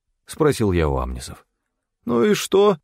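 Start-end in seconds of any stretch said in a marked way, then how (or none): 2.1-2.8 high-pitched uneasy retort; worried. A narrator's reading. Stefan reacts to Til hearing a sob, worried and high. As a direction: raise the pitch in mild alarm. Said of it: "тут же забеспокоился Стефан".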